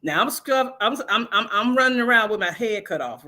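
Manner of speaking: ordinary talk, nothing marked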